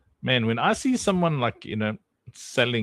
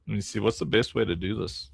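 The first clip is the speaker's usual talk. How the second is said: ordinary talk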